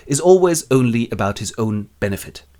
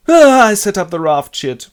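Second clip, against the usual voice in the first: loud grumble; 9 semitones higher, 9 dB louder than usual. Acted. Robinson struggling with raft.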